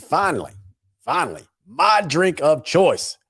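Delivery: put-on hype voice. Host hyping the sponsor's drink in a put-on voice. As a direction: put on a big voice for the product. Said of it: "why I'm using that voice".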